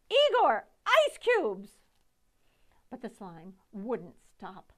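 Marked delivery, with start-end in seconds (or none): 0.1-1.8 high loud call for ice cubes; urgent shout. Acted. Victoria shouting at Igor for ice cubes. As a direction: yell it desperately. Panicked order.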